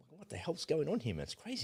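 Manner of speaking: high and hushed, incredulous; baffled disbelief. Retold or imitated. Host reacting to the royal inspection footage, hushed high disbelief. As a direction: mutter it like you can't believe your eyes.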